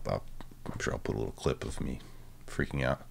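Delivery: normal talking voice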